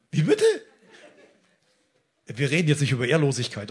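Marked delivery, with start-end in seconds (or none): 0.1-0.4 high-pitched incredulous question; stunned, rising reply; acted disbelief. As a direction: shoot up high in mock shock.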